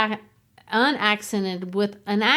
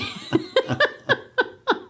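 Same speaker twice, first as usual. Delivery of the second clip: breathless giggle. Real, not acted. Embarrassed laugh after stork joke.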